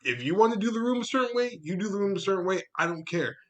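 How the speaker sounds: high, pressing, insistent retort; heated ultimatum. Retold or imitated. Crew member telling the assistant chairs come first, heated ultimatum. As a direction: press the point fast and firmly.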